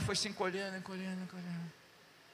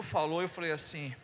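ordinary talk

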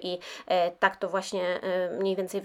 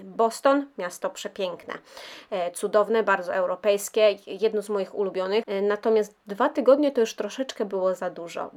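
ordinary talk, nothing marked

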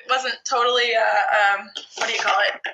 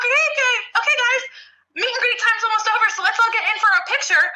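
staff wrapping up; 9 semitones higher, faster than usual. Retold or imitated. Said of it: "everyone's like".

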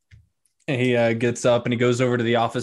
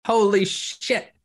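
much higher, stunned barkeep; astonished Larry; 10 semitones higher than usual. Acted. Larry shocked by the hundred gold notes, high astonishment. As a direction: gape at the pile of gold in disbelief.

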